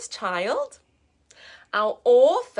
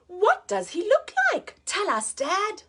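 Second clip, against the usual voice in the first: put-on higher eager little Gruffalo child; Gruffalo's child begging Dad for the story, higher; 6 semitones higher than usual. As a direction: ask like an excited little kid.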